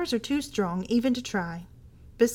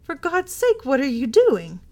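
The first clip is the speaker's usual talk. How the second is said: louder, frantic plea, pitched high at first; horrified cry; 5 semitones higher, 8 dB louder than usual. A narrator's reading. Husband horrified at his wife on the floor, crying out. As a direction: cry out in horror at what you see. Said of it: "he cried".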